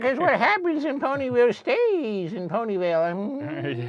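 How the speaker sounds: put-on high, slow, loud old lady voice; old granny voice. Voicing Granny Smith.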